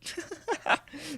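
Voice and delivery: sudden high-pitched burst of real laughter. Real, not acted. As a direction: crack up at a comment. Laughing at a chat comment.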